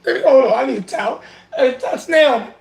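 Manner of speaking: high-pitched, hammed-up sobbing plea; comic tearful meltdown; mock crying. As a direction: wail as if breaking down in tears. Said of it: "I'm trying not to cry".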